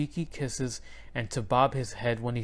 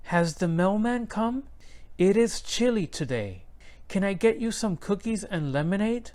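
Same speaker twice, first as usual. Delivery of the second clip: Abuela's careful English; 7 semitones higher than usual. A narrator's reading. Abuela speaks English to neighbors.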